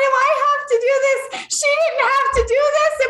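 high, plaintive mock complaint; hammed-up high whine; whining protest. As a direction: imitate a friend wailing that it's unfair. Imitates friend's protest at being next.

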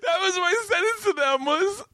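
very high, squeaky talk through real laughter; helpless laughter. Real, not acted. Comic recalling his silly Russian sentence, cracking up. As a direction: struggle to get it out while cracking up.

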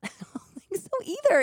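high, laughing agreement; amused agreement, pitch lifted by laughter. Real, not acted. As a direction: talk through a laugh. Laughs along at failed plan.

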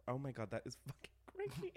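ordinary talk

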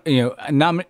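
ordinary talk

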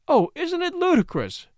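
about to burst out laughing; amused exclamation. A narrator's reading. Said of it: "burst into hearty laughter".

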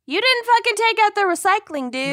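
high, fast, fed-up scold; exasperated scolding. Retold or imitated. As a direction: tell him off, exasperated. Scolding partner over recycling.

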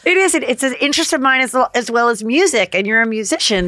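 a hint of a bubbly realtor; lightly voiced Chrishell flirting. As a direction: play Chrishell meeting her future wife. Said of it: "I'm gonna be Chrishell".

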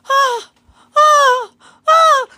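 very high, loud, short mock shrieks; wordless panicked cries. Startled diner thinks it is a hyena, panicked wordless shrieks. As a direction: squeal in repeated bursts, too panicked for words. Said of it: "I can't form any words".

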